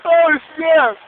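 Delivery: loud, high, laughing yells; laughing shout. Real, not acted. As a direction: burst out laughing and yelling. Friend slips, laughing shout.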